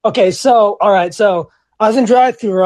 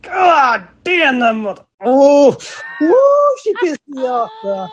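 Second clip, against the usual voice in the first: exasperated outburst; 6 semitones higher, slower than usual. Retold or imitated. Angry outburst after customer leaves.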